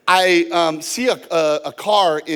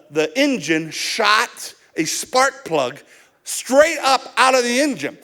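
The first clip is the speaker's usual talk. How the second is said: ordinary talk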